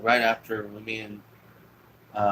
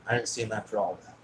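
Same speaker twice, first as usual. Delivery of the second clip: ordinary delivery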